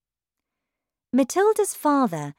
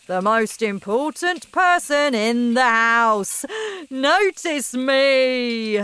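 pompous loud father; 5 semitones higher, 6 dB louder than usual. Acted. Father announcing himself to the family, loud and pompous. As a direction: announce yourself like a self-important king. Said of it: "You could almost hear him saying".

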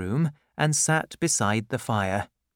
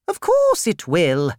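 brisk aunt; 6 semitones higher, 6 dB louder than usual. Acted. Miss Betsy voiced.

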